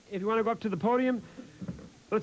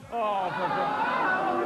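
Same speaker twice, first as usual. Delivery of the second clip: drawn-out disgusted sigh, pitched up; fed-up host groaning at a nudist's letter; exasperated groan; 7 semitones higher, much slower than usual. Acted. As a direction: let it sag in disbelief.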